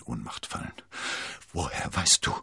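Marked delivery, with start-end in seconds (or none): 1.5-2.3 a hint of faltering stammer. A narrator's reading. Milady stammering in shock to Athos. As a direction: stumble over the words. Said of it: "stammelte sie".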